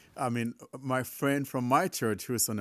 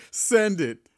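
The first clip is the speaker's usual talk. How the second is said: loud command; 8 semitones higher, 9 dB louder than usual. Real, not acted. Frustrated command to phone.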